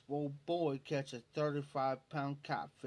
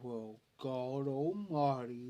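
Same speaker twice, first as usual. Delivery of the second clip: slow, drawn-out awestruck drawl; slow-talking Leroy impression; 3 semitones lower, much slower than usual. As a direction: imitate a slow talker, stretching the vowels. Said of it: "That's how he talked".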